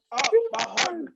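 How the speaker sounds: high, breathy-free gasp of shock; mock wounded cry; mock heartbreak. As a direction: clutch the chest, pitch way up. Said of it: "I'm like".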